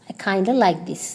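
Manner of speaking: "kinda" with a schwa ending, no f; shortened form. Shown as a demonstration. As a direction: blend kind of into "kinda". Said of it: "you can shorten it and say".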